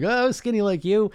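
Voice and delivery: lightly voiced mother sighing; mom. Son imitating his mom lamenting her lost figure. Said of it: "she'd be like".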